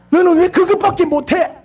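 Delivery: high, sharp telling-off; harsh scolding. Shown as a demonstration. Parent berating a child as a bad example, harsh scolding. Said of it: "이러면 안 돼".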